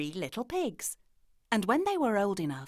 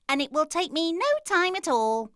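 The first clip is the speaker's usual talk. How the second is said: little pig voice; 9 semitones higher, 5 dB louder than usual. A narrator's reading. Pig character voice.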